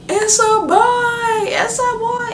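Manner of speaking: very high, loud, slow tearful cry; overjoyed. Retold or imitated. Mother seeing her newborn son, high and tearful.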